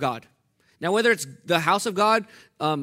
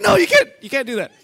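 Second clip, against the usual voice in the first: higher, louder laughing brother; much louder laughing no; 8 semitones higher, 10 dB louder than usual. Retold or imitated. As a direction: laugh through the brother's alarm.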